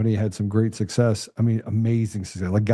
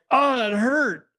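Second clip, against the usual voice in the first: mock hurt cry; much slower than usual. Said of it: "and I was like".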